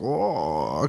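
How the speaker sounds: low, soft drawn-out mock zombie moan; zombie groan. Co-host imitating the zombies, low groan.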